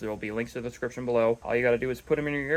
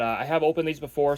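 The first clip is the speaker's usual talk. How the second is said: ordinary talk, nothing marked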